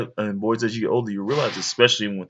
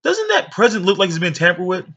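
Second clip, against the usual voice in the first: higher, louder mock-suspicious lady; nosy lady voice; 8 semitones higher, 4 dB louder than usual. Lady tattling to mom about the present, higher and louder. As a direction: point out the tampered present, pitched up and nosy. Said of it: "And she's".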